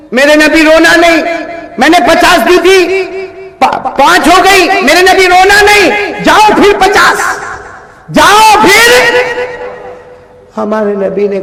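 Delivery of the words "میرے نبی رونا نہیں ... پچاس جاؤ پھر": high, impassioned proclamation, last words held long; full-force preaching. Acted. Preacher voices God consoling the weeping Prophet, high full-force preaching. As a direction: build to a ringing, drawn-out shout.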